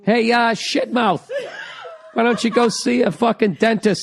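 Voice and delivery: blunt mock insult to a partner, laughing; mock rude. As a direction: bark a playful insult. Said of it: "how can he tell it".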